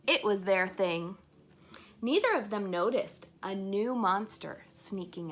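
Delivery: put-on lively read-aloud to children; story narration. A narrator's reading. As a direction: read it out bright and bouncy for kids. Reading a picture book aloud.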